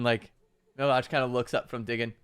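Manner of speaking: usual speaking voice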